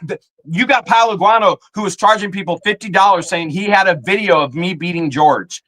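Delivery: usual speaking voice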